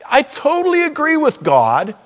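ordinary talk

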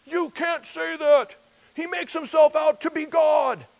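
high, shouted, indignant protest; outraged leaders. Pretend. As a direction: shriek it like a scandalised leader. Religious leaders outraged at Jesus.

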